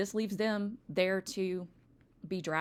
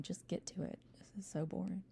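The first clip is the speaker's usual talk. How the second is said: imitating bored students under the breath; very soft, near-whispered mock grumble; bored eye-rolling; 10 dB quieter, faster than usual. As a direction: complain quietly, as if rolling your eyes. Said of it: "rolling your eyes and going".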